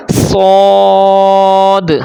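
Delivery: صاد held very long with a heavy, rounded vowel; sharp s opening into a long, thick صاد; thick whistled s. Shown as a demonstration. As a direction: recite صاد full-mouthed on a steady note. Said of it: "اس کو موٹی آواز کے ساتھ".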